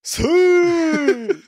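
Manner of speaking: high, drawn-out whooping call; imitated yell. Co-host imitating the overtaking racer's shout, high whoop. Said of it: "he'd yell out".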